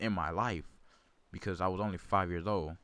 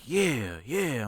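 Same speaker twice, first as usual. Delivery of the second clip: excited yeah; 4 semitones higher, 4 dB louder than usual. Retold or imitated. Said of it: "and I'm like".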